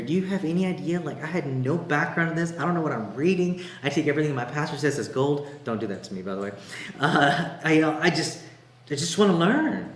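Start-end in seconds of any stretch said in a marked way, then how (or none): 0.0-5.5 higher, animated clueless beginner; eager new believer. Retold or imitated. Preacher recalling his early days as a believer, animated. 7.0-9.9 higher, louder, stumbling eagerness; eager beginner. Retold or imitated. Preacher recalling wanting to learn, eager and stumbling.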